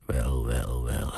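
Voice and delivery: low, understated chuckle on the words; a touch of a dwarf's snigger; chuckling. A narrator's reading. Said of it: "grinnikte hij". (licht grinnikend)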